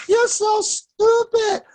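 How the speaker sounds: high, loud, slow giggling jeer; laughing taunt. Retold or imitated. Therapist teasing an aroused client, high slow laughing jeer. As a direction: drag it out through the laugh.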